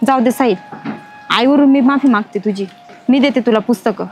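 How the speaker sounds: low, gentle apology; tender apology. Acted. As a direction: soften to console him. Daughter apologises to Sahil.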